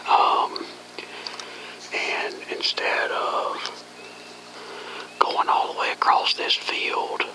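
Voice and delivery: hushed field whisper to the camera. Real, not acted. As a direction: whisper so the coyotes won't hear.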